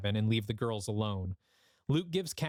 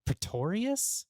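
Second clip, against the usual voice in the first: slow high questioning Pretorius?; puzzled repeat; 8 semitones higher, slower than usual. Acted. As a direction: echo the name back in disbelief. Beth repeats doubtfully.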